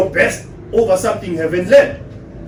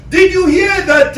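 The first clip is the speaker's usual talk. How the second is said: high, louder gossipy opener; mock husband; 11 semitones higher, 5 dB louder than usual. Husband telling wife about the power cuts, high mock gossip. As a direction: play a husband bursting with news. Husband voiced in acted dialogue.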